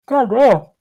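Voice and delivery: yawning. Real, not acted. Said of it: "makes me yawn".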